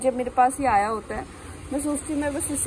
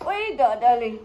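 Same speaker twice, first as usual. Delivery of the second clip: louder cooing call; 6 dB louder, slower than usual. Real, not acted. Calling dog again, louder.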